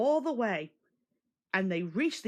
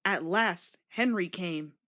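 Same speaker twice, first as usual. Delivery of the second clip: usual speaking voice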